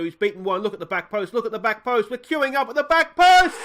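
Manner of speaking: rising from lively to loud high call; building excitement. Real, not acted. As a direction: build it up as the attack unfolds. Building attack commentary.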